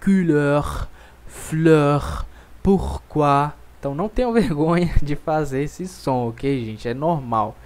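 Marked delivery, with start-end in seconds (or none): 0.0-3.5 "couleur" with a guttural R from the throat; "pourquoi" with a French throaty R. Shown as a demonstration. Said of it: "a letra R é bem aqui na garganta".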